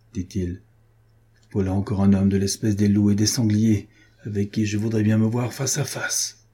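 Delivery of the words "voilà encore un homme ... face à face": plain reading voice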